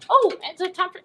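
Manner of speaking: high, loud interrupting squeal; eager banana character. Pretend. Banana butting in before the play starts, high and eager. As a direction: burst in high and too early.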